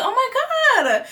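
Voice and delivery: high, slow delighted oh my God; mock gasp of surprise; surprised squeal. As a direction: act the shock of seeing him. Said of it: "I looked up and I'm like".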